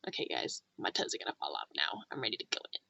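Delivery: soft breathy whispered aside. Retold or imitated. Whispering to the campfire guys that her toes are freezing. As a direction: whisper it like a secret. Same line, whispered retelling.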